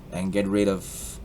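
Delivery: ordinary talk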